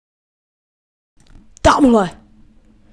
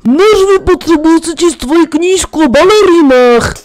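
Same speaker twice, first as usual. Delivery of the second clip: mock character voice; 5 semitones higher, 8 dB louder than usual. Friend barges in, put-on voice.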